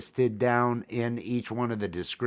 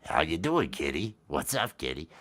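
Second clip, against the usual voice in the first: deep pet voice; 5 semitones higher, much faster than usual. Shown as a demonstration. Husband showing his own low voice to the cat. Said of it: "What they hear on mine".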